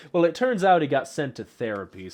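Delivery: normal talking voice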